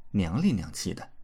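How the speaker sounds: plain reading voice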